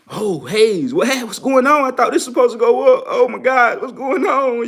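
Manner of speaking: high, loud frantic whining over a losing trade; panicked trader. Retold or imitated. Imitating a panicked trader begging the coach for answers, high and frantic. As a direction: mimic a trader freaking out as the price drops.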